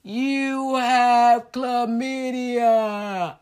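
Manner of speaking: very slow, dragged-out words; slow motion. Retold or imitated. Hearing the doctor's news, dragged out in slow motion. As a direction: stretch every word like a slowed-down tape. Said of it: "it went in slow motion for me".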